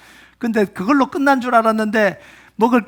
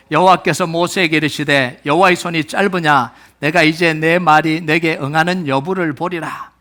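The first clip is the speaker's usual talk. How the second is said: firm, measured read-aloud for a congregation; formal, evenly projected recitation; scripture reading; 3 semitones lower than usual. Real, not acted. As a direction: lead the verse in steady unison pace.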